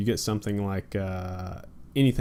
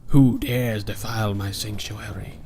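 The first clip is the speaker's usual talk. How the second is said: creepy monster voice; slower than usual. Acted. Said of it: "It's just".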